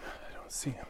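hushed, nervous whisper. Real, not acted. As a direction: whisper so he won't hear. Hiding from a stranger.

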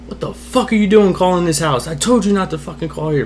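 high-pitched retold scolding; angry dad. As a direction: scold like dad, without full volume. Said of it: "And then he yelled".

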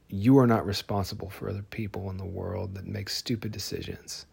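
usual speaking voice

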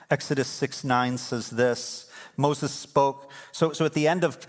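usual speaking voice

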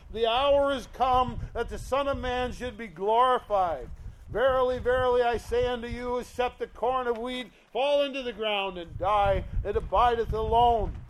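loud, ringing scripture reading; preaching scripture. Acted. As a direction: proclaim the verse to the congregation. Reads scripture at full force.

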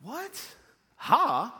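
high-pitched, baffled questioning; high, incredulous query; puzzled reaction. Retold or imitated. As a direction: imitate a team baffled by what they read. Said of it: "Is he laughing?".

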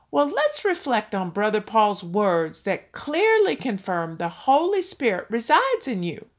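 usual speaking voice

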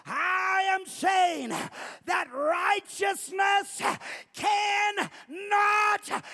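booming, high, slow shout; voice of God. Acted. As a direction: proclaim each word slowly as God. Preacher voices God's answer.

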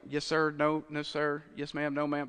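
low, soft rote replies; dutiful obedience. Acted. Preacher recalls learning manners early, dutiful rote replies. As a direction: recite them like a well-drilled child.

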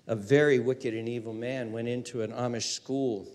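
ordinary talk

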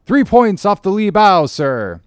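high, loud hail to the deck; lookout calling down. Acted. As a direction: call it down from the rigging. Lookout calls down to captain.

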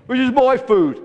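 high, loud territorial cry; screech. A narrator's reading. Greedy gannet to approaching seagulls, screeched. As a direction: screech it possessively, high and forceful. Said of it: "he screeched".

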